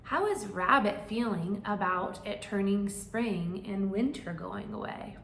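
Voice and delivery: usual speaking voice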